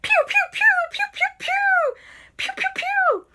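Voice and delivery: very high squeaky laser shots; imitated zapping. Friends zapping the ghost with phones, laser noises. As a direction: fire off rapid high pews. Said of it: "they came out and they went".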